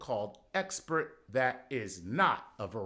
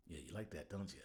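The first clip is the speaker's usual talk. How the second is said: much softer, low teasing purr; mock seductive purr; 8 semitones lower, 14 dB quieter, faster than usual. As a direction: tease the audience like a lover. Seductive aside to audience.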